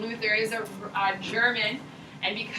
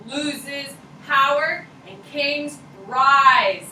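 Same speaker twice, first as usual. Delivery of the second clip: slow emphatic; 5 dB louder, much slower than usual. Real, not acted. Teacher stresses key fact.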